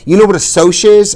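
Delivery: shaken with rage. A narrator's reading. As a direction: fire it back in rage. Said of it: "shaken with rage".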